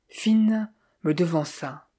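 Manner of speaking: plain reading voice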